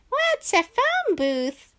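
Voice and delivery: put-on high, slow puzzled kid. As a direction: ask like a clueless kid.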